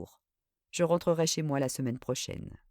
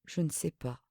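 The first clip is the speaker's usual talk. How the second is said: murmured; 2 semitones lower, 7 dB quieter than usual. A narrator's reading. Cornered cousin admitting she doesn't know, murmuring. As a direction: let it fall away, barely audible. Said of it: "puis murmura".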